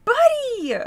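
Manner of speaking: loud, high-pitched scolding; exasperated calling out. Real, not acted. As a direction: yell at the cat to get his attention. Scolding the intruding cat.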